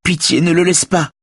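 very high, desperate begging; acted panicky appeal for mercy; imploring. A narrator's reading. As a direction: plead frantically in a high female voice. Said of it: "l'implore Véronique".

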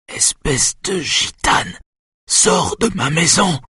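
slow, high hissed insult turning to a cry; venomous outburst. A narrator's reading. Mother ordering Simone out of her house, venomous. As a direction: drag out the words with venom, pitch high.

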